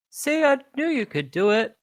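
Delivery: high, chirpy apology; a bright creature praising the fighter; cheery creature voice. Acted. Creature praising the fighter who cracked it, high and chirpy. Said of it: "but it goes".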